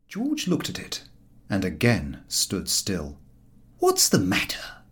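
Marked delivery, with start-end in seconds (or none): 3.8-4.8 kindly concern. A narrator's reading. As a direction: ask gently, like a nurse. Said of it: "said Harris kindly".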